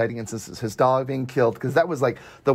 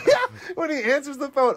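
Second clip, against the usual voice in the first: much higher, words squeezed out through laughter; laughing hard; 10 semitones higher than usual. Real, not acted. Recalling the funny phone scene, laughing hard.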